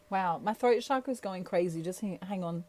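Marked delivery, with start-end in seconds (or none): none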